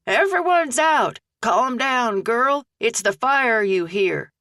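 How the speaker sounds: high, brusque holler over the noise; hollering back. Acted. Old McHenry hollering back at frantic Aggie, brusque holler. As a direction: shout her down, gruff but not unkind. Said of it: "McHenry hollered back at her".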